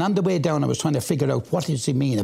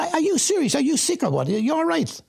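high, disbelieving questions; reliving the shock at his brother's news; pitched-up mock alarm at a brother gone mad; incredulous. Retelling shock at brother's conversion.

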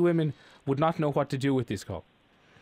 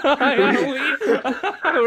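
mocking laugh; 7 semitones higher, 4 dB louder, much slower than usual. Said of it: "almost sounded like he was from North Dublin".

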